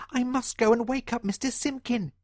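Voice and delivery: high, small determined mouse; a tiny mouse making up his mind. Acted. John Mouse deciding to act, squeaky. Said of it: "he thought".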